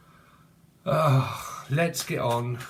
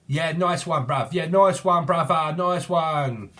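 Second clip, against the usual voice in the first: put-on London accent; 3 semitones higher, 4 dB louder, slower than usual. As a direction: say "nice one, bruv" like a London lad. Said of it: "that's a film".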